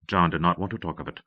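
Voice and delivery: ordinary delivery, nothing marked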